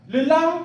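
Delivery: "le la" with "la" pitched high; Yemba word le la. Shown as a demonstration. Teacher reading "le la" aloud with a high tone. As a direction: say "le la" with the second syllable lifted high.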